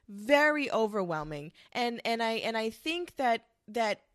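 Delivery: normal talking voice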